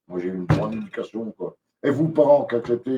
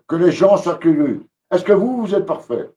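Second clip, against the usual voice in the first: ranting outburst; 8 semitones higher, 6 dB louder than usual. Real, not acted. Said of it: "Désolé pour mon coup de gueule".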